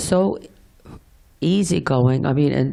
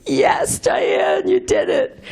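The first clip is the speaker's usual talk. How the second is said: overjoyed; slower than usual. Retold or imitated. Sees Buddha sign, overjoyed.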